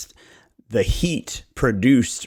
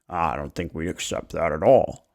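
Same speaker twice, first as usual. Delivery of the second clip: lower, softer put-on seller turning it down; stubborn seller voice; 8 semitones lower, 5 dB quieter than usual. Homeowner rejecting the lower net offer, low stubborn voice. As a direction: dig in as the reluctant homeowner.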